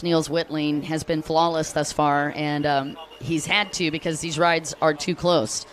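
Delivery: normal talking voice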